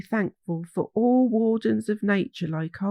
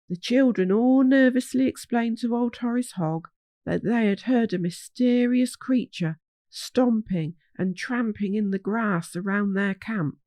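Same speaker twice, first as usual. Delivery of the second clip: usual speaking voice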